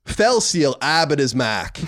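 put-on slow, drawn-out Boston accent, r dropped: "Abbott is Mac". Cohost answers a listener request in a slow Boston accent. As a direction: lean into the Boston accent slowly. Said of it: "pronounce Fell Seal Arbiter's Mark with a Boston accent".

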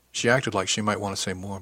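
ordinary delivery

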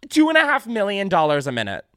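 high loud shout of disbelief; 1 astonished shout. Real, not acted. Host echoing the fact checker, astonished shout. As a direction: yell the number in shock.

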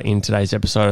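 usual speaking voice

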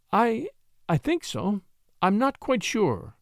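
a flustered, lightly stammered reply; stammering. A narrator's reading. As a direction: stumble shyly over the start. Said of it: "she stammered".

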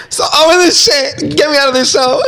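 high, laughing mock despair; laughing exasperation. Real, not acted. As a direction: laugh it out like you're fed up.

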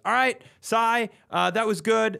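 a brisk set director redirecting the actors; director notes. Retold or imitated. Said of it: "the director goes".

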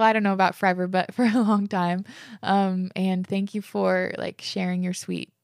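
ordinary delivery

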